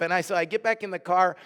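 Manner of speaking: usual speaking voice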